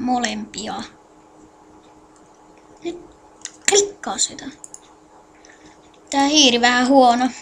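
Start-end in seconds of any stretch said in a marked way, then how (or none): 2.8-4.5 whisper. Real, not acted. Whispered aside while clicking.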